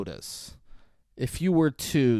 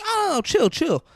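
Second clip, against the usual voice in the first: high, louder "chill" as in a movie retelling; retold calming exclamation; 4 dB louder than usual. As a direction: imitate someone heading off a startled guy, pitched up high. Retelling Jackson calming a startled guy.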